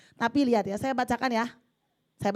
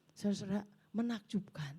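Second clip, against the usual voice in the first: hushed awe; 9 dB quieter than usual. Real, not acted. Awed at the letter.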